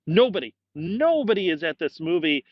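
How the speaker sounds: usual speaking voice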